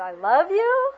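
high, loud, drawn-out rising sarcasm; sarcastic. Shown as a demonstration. Teacher showing the class sarcasm, high and exaggerated. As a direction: exaggerate it upward in mocking disbelief.

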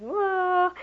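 high loud whoa; dismayed whoa. Shown as a demonstration. Teacher modelling the kids' dismay, surprised. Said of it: "disappointment demo".

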